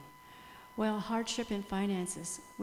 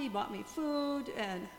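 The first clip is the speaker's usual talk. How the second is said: usual speaking voice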